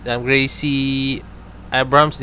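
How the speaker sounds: Gracie Abrams said very slowly, feeling out each syllable; careful unsure pronunciation. Real, not acted. Vlogger unsure how to say a singer's name, careful slow try. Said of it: "di ko sure kung tama pronunciation ko".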